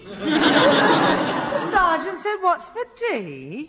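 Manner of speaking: slow, disbelieving repeat, rising high; incredulous echo. Acted. Mother repeats the sergeant's words in slow disbelief. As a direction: draw it out as if she can't believe it.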